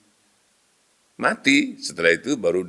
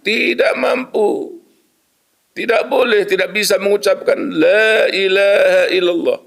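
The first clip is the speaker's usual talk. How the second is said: anguished; 9 semitones higher than usual. Retold or imitated. Dying man fails to say creed.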